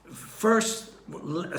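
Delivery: ordinary talk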